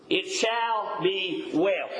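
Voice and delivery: slow, deliberate quote. Acted. Repeating the key quote slowly.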